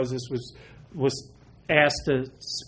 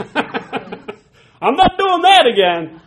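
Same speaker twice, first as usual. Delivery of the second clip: much higher, loud, slow laughing vow; 13 semitones higher, 10 dB louder, much slower than usual. Real, not acted. Pastor joking about his own repentance, slow laughing. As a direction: laugh it out, swearing off.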